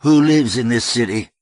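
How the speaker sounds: loud, high pulpit voice; fiery preaching. A narrator's reading. Reverend Amy preaching to the hall, fiery. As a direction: ring it out to a crowd.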